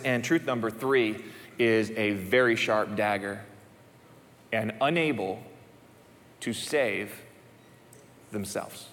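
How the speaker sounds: heavy sermon emphasis with long pauses; weighty, slow emphasis. Shown as a demonstration. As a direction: let each word sink in. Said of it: "Let that sink in".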